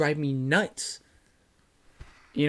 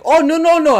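high, loud string of noes; imitated flustered refusal; 14 semitones higher, 11 dB louder than usual. Imitating a cleaner who speaks no English, high and flustered. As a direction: mimic someone waving off the question.

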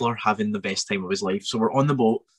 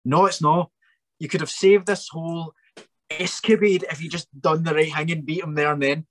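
raised, higher, exasperated; heated rant; 6 semitones higher than usual. Real, not acted. Fan ranting at Goku for not finishing the villain, exasperated. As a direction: argue it like a wronged fan.

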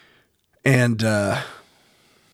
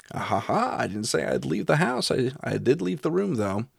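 teasing gotcha, pitched up; gloating; 5 semitones higher than usual. Retold or imitated. Investigator who tricked the entity by a loophole, gloating. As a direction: gloat playfully over a sneaky trick. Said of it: "So, haha, on the entity".

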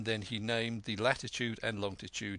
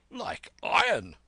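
loud, high, drawn-out declaration; emphatic reply; 11 semitones higher, 7 dB louder than usual. A narrator's reading. Squire answers the captain about the cruise, emphatic. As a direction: ring it out slowly and forcefully.

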